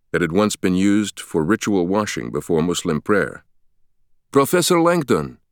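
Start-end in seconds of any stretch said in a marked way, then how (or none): 4.3-5.4 calling out. Acted. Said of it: "a man's voice shouted".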